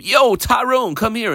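high casual hail to come over; calling out. Retold or imitated. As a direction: call him over from afar. Teacher voices calling a slave.

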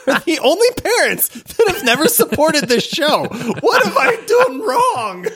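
high-pitched, laughing cry of grievance; mock outraged lament. Mock complaint about parents not supporting show.

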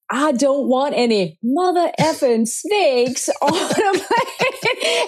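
high, laughing mock Samuel Jackson rant; playful imitation of the actor's famous rant; Sam Jackson impression. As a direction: laugh through a hammed-up Samuel Jackson line. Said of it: "when he's like".

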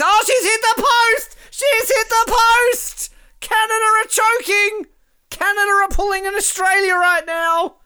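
high, loud, breathless commentary yell; real thrill at a near miss; excited shouting. Real, not acted. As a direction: scream it like the post just rang. Live shootout, penalty hits post.

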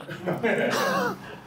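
a burst of high-pitched laughter; laughing at the friend's joke, high. Real, not acted. Laughing at the friend's joke about food, a high burst of laughter.

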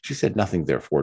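plain reading voice

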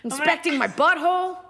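high-pitched exasperated cat rant; worked-up streetwise cat voice; Gracie. Acted. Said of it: "This is Gracie".

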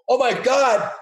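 high, loud fake alarm at something behind you; mock panic. To armed terrorists, faking terror of a threat behind: high, loud mock alarm. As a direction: shriek as if danger is coming the other way. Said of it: "pretend that someone was coming the other way".

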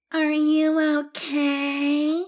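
high, slow, cooing fake sympathy; mock concern. Podcaster mocking people checking on someone upset, cooing mock concern. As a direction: parody a hovering comforter.

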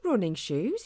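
ordinary delivery

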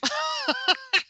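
sudden high, loud laugh; real high-pitched burst of laughter. Real, not acted. Laughs at own ego remark.

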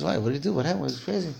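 soft, hushed questions from the Rav; gentle Rav. Acted. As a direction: ask her quietly and kindly, like an old rabbi. Joke, Rav questions the mother.

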